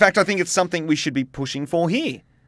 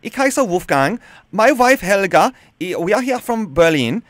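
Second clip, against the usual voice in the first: hammed-up German accent, deliberate and emphatic; badly faked German caller; put-on German accent. Shown as a demonstration. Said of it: "with a German accent".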